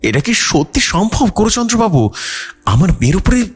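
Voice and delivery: high, anxious pleading mother; fearful mother. A narrator's reading. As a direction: ask the priest with worry. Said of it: "Kalyani ভয় ভয় বললেন". (পুরোহিতকে প্রশ্ন করা ভীত মা, উঁচু উদ্বিগ্ন গলায়)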